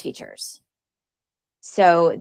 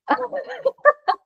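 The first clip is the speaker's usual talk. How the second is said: high, real laugh. Real, not acted. Lecturer recalling a bad day, laughing.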